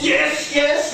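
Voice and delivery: high-pitched, excited yarn-spinner's yes; mounting excitement. Acted. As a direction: act out breathless hope, voice jumping right up. Told lottery ticket number matches.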